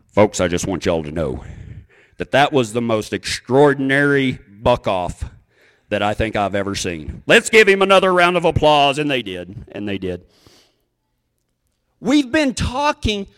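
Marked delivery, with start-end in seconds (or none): 0.1-9.0 deep announcer voice, rising for the applause call; rodeo announcer. Retold or imitated. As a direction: play the announcer addressing the crowd, starting very low. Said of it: "the announcer said this".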